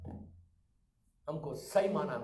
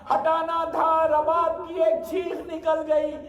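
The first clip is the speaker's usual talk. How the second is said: high, loud wailing narration, stretched slow; full-force mourning sermon; 12 semitones higher, 12 dB louder than usual. A narrator's reading. Preacher nearing the climax of Karbala, wailing lament. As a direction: cry the tragedy out to the gathering.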